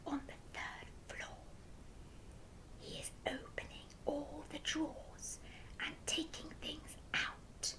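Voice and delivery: urgent unvoiced whisper. A narrator's reading. Giraffe warns the Duke in a whisper. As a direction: whisper the danger close to his ear. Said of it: "came up very close to the Duke and whispered".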